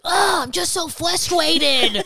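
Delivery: slow, high, loud mock toddler whine; imitating toddler. Mom imitating her four-year-old's dramatic complaint, slow and high. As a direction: drag it out like a fed-up little girl. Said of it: "she'll be like".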